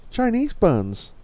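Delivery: high, drawn-out exclamation; put-on character voice. Game character picks between two buns, high put-on voice. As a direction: pitch it high and stretch it out.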